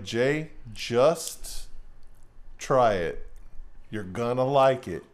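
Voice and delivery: low, measured persuasion, pausing between phrases; coaxing friend. Retold or imitated. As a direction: imitate a pal calmly talking you into it. Voices friend coaxing him.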